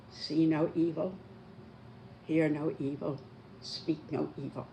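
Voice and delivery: ordinary talk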